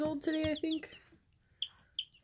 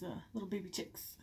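ordinary talk, nothing marked

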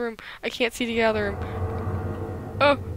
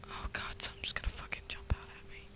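scared whisper; 18 dB quieter than usual. Real, not acted. Scared whispering in horror game.

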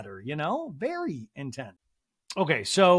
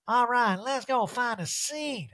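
higher, slow, drawn-out impression; Erica; 7 semitones higher, slower than usual. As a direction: stretch it out in a put-on diva voice. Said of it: "She's like".

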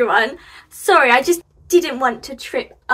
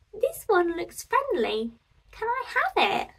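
higher, gentle hopeful asking; shy little boy; 8 semitones higher, 4 dB quieter than usual. Acted. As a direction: ask softly like a timid little boy. Little boy finds happy pumpkin.

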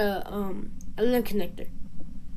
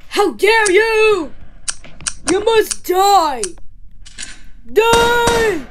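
furious shout; 5 semitones higher, slower than usual. Acted. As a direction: shout each threat, stretching the last word. Avenging Freddy in acted fight.

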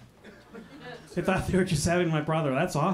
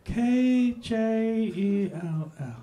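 letters read very slowly, puzzled; slow dumb reading; 2 semitones higher, much slower than usual. Retold or imitated. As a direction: sound out K J E L L slowly like a confused customer. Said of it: "he goes".

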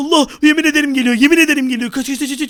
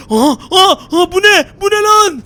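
louder, higher startled cries; startled shout; 5 semitones higher, 6 dB louder than usual. Acted. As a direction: jump at a sudden sight. Villain suddenly appears. (şaşkın bağırış)

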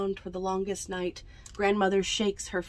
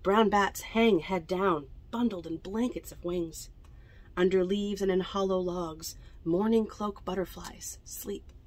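ordinary delivery, nothing marked

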